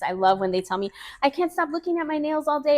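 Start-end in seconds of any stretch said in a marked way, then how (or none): 1.2-2.8 high-pitched client gush; thrilled client. Retold or imitated. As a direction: imitate a delighted customer. Quoting a thrilled client's praise.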